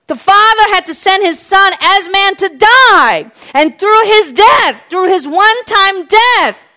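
fiery preaching shout; full-force preaching. Real, not acted. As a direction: thunder it from the pulpit.

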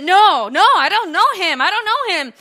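loud, high frantic denial; panicked denial. Acted. Peter denying Jesus to the crowd, loud and panicky. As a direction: deny it in a rush. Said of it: "He said".

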